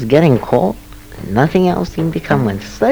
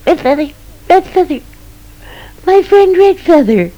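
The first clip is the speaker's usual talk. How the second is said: much higher, tiny squeaking field mouse calling out; squeaky mouse voice; 4 dB louder, faster than usual. Acted. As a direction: squeak it like a delighted little mouse. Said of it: "the little squeaking voice said".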